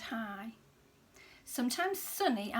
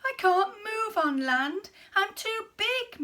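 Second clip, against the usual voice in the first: high, loud despairing moan; moaning whale; 10 semitones higher, 8 dB louder than usual. A narrator's reading. Beached whale despairing to the snail, moaning. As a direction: wail that he is stuck. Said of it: "moaned the whale".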